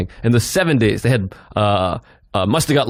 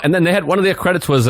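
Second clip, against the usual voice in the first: ordinary talk, nothing marked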